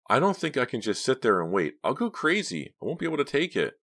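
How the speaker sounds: high, worried character voice; anxious young woman. A narrator's reading. Driver dreading waiting alone, anxious. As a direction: protest with rising pitch and nerves.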